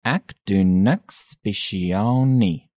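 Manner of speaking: "Ek doen niks spesiaal nie" slowly, word by word; slow demonstration. Shown as a demonstration. Teacher repeats response slowly.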